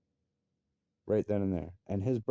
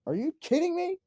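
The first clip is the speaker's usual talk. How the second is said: much higher, outraged disbelief; incredulous. Real, not acted. Father hearing the suspended worker got promoted, much higher disbelief. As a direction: squeal it in total disbelief. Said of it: "hearing of her promotion".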